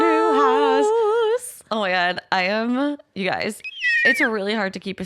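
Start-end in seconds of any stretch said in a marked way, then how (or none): 0.0-1.6 high, loud squeal; excited squeal. Real, not acted. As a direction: squeal it with joy. Echoing the news in excitement.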